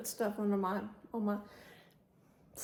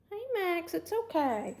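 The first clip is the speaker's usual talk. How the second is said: high, gentle pet-talk voice; calming Max, who sits watching; soothing the dog; 8 semitones higher than usual. Real, not acted.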